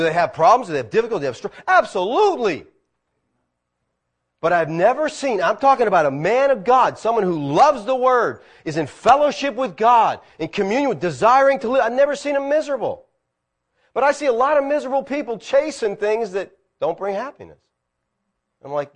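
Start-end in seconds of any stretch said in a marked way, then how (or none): 4.4-15.4 higher, louder preacher's drive; fervent preaching. Acted. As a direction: build to a pulpit crescendo. Preaching at full force.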